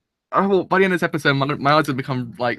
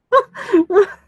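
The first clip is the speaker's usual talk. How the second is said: a high, choked sob breaking out; tearful sob; 5 dB louder than usual. Real, not acted. Viewer moved by an anime episode, sobbing. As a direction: let a real sob catch in the throat. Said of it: "I'm crying".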